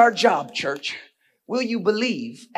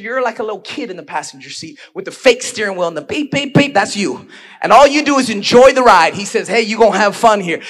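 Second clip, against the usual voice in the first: loud, rising preacher's delivery; preaching at full force; 6 semitones higher, 16 dB louder than usual. Acted. Pastor pressing the church hard, booming preaching.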